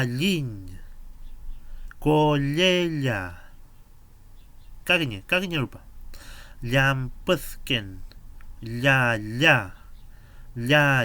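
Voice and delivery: Llampuzken drawn out very slowly, ll sounds stressed and loud; each syllable of Llampuzken stretched out loud and clear; Mapuche ll sound. Shown as a demonstration. Teacher modeling the ll sound for beginners, slow and loud. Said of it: "Repitan después de mí".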